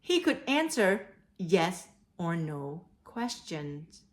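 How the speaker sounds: ordinary talk, nothing marked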